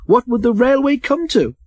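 high, outraged rhetorical question; scandalised and pompous; pompous indignation. A narrator's reading. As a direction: protest with self-importance, pitch held high. Pompous James scoffing at Toby.

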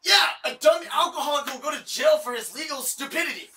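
angry shouting. Real, not acted. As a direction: yell it across the yard. Yelling at drunk neighbour.

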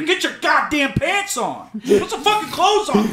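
high, laughing mock yell; retold yelling. Boyfriend yelling at his girlfriend to get dressed, laughing shout.

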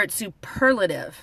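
ordinary delivery

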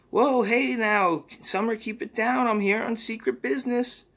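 very high cartoonish creature voice; Mister Poopy Butthole. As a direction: chirp it out like a goofy sidekick. Mister Poopy Butthole hushing Summer.

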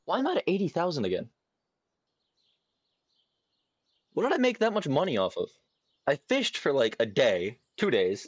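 high baffled rush. Real, not acted. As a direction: puzzle it out high. Confused by high money.

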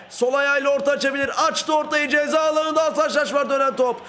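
excited commentary rising and falling with the attack; live play-by-play. Pretend. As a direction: call the passes like a match commentator. (iniş çıkışlı heyecanlı maç anlatımı)

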